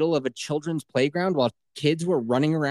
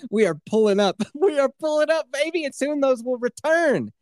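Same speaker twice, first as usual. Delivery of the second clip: much higher, laughing triumph; giddy triumph; 13 semitones higher than usual. Real, not acted. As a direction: celebrate the comeback giddily.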